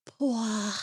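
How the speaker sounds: imitating a sensual French "poire". Host copying the search voice, sensual "poire". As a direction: linger suggestively on "poire", as if seductive.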